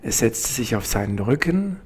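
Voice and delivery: normal talking voice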